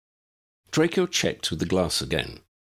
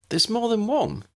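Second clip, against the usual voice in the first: put-on girl's voice. A narrator's reading. Lydia voiced by the reader.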